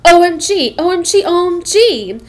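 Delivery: high, loud excited squeal; inner squeal. Retold or imitated. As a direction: squeal it in your head like a fangirl. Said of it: "My brain was like".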